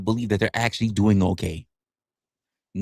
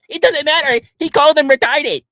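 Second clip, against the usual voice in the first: high, louder amused outburst; excited; 13 semitones higher, 5 dB louder than usual. Real, not acted. Streamer amused by chatters, raising voice. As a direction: hype it up gleefully.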